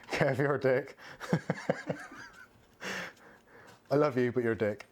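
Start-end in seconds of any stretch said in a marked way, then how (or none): 0.1-1.0 real laugh in the voice, fond jab; amused, laughing tease; laughing insult. Real, not acted. Laughing at a viewer's teasing comment. 1.0-2.2 real, breathy, voiceless chuckle; wheezy run of chuckles; amused laughter. Real, not acted. Laughing at a viewer's teasing comment.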